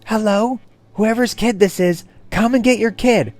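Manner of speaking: loud, high call for the parent; shouting. Retold or imitated. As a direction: yell through the cubicle for the mom to hear. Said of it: "She started shouting".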